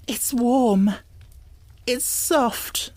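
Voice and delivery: high, near-tearful catch; on the edge of tears; almost sobbing. A narrator's reading. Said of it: "she almost sobbed".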